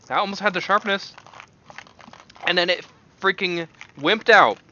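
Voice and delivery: loud, high, exasperated; worked-up complaint. Real, not acted. Vlogger tasting a stink bug bean, loud exasperated gripe. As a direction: gripe loudly that it wimped out.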